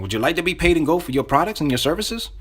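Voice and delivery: upbeat question, lifted at the end; enthusiastic. Shown as a demonstration. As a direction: sell the offer with enthusiasm. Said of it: "Say it with enthusiasm".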